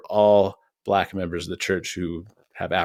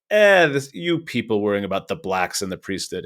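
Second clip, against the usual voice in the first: imitated dismissive tone. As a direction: imitate someone waving worriers away. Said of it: "very much a tone that he took on".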